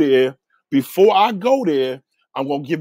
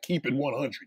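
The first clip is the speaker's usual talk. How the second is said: much softer, stiff and square; corny mocking quote; 3 semitones lower, 12 dB quieter than usual. Host mocking a corny caller's slang, soft stiff imitation. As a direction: mimic an older man trying to be young, under your breath. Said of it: "who the fuck even talks like that?".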